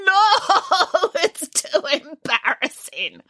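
high, slow embarrassed wail with a laugh-sob; wailing bear. Acted. Boris wails to Nanny through the locked door. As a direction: wail it out like an ashamed child. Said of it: "wailed Boris".

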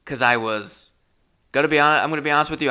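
ordinary talk, nothing marked

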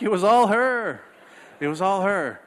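high-pitched, hammed-up excuse; mock defensive protest. Dad to judging parents, mock defensive whine.

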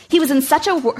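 plain reading voice

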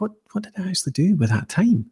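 high, fast baffled question; bewildered. Retold or imitated. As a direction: wonder where the time went. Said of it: "you're like".

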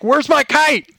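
put-on high excited kid's demand; child pouncing on stepdad; eager demand. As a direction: blurt it out the second the door opens. Kid demanding kite from stepdad.

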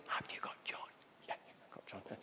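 whispered quick check; hushed question and answer. Acted. Parents on the beach checking on their son, whispered.

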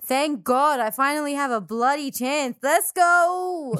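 loud, high, gleeful celebration; playful over-the-top rejoicing; mock elation. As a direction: cheer in hammed-up triumph. Mock celebration in put-on accent.

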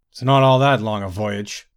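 loud, mildly angry objection. A narrator's reading. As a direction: cut back with a touch of anger. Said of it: "said one of the grey cloaks angrily".

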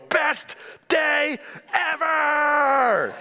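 high, drawn-out joyful shout; excited kids' shout. Retold or imitated. Pastor voicing his boys clutching their dollars, cheering. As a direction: cheer it slowly like thrilled little boys. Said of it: "they go".

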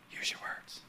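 soft unvoiced whisper; whispered Holy Spirit. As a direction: breathe it like a still small voice. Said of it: "Holy Spirit is saying to you".

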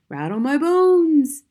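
slow, high ghostly wail; haunting parrot voice, long vowels; spooky voice. A narrator's reading. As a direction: draw it out like a ghost. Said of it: "soft and spooky this time".

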